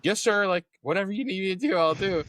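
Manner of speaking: high-pitched, obedient mock reply; eager compliance. Acted eager reply to intimidating sergeant.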